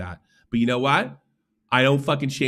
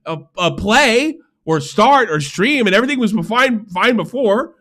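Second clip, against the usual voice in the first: high, loud whiny complaining; mock a whining streamer; whiny mock complaint; 10 semitones higher, 6 dB louder than usual. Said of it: "And they're like".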